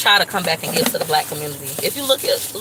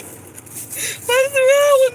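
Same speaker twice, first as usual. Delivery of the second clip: much higher, very slow, stretched hammed-up sob; mock wailing cry; much slower than usual. Lamenting to viewers about being unmarried, hammed-up wailing cry. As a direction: wail it out like a fake crying fit.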